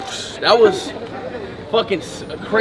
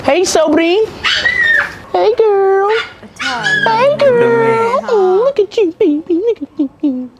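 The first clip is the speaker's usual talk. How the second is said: much higher, cooing sing-song greeting; 13 semitones higher, 4 dB louder, much slower than usual. Real, not acted. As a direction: coo at her like a doting friend. Cooing over someone called Sobri.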